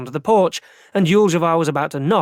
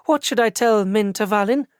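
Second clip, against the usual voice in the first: put-on landlady calls out in a Barajese accent, pitched higher; 6 semitones higher than usual. A narrator's reading. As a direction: Barajese landlady addressing the arrested boarder. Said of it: "she said in a strong Barajese accent".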